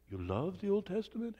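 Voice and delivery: mock surprise, high and soft; lightly acted passenger's disbelief; surprised passenger. Voicing surprised fellow plane passenger.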